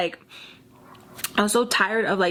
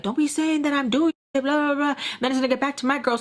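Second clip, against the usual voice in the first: higher-pitched annoyed protest; mock mad; 5 semitones higher than usual. Guy objecting to a rumor about him, mock mad. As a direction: complain crossly in a higher voice. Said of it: "that guy gets mad".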